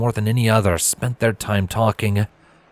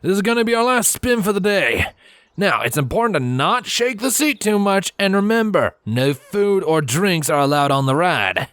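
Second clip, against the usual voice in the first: much higher, cheeky stallion voice, slowing at the end; cheeky ride operator; 10 semitones higher than usual. A narrator's reading. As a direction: announce the rules with a cheeky grin.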